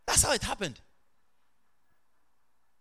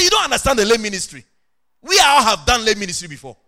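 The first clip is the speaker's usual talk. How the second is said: loud, higher-pitched retold rebuke; scolding; 5 semitones higher, 12 dB louder than usual. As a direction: reenact putting a colleague straight forcefully. Retells scolding Kizzy.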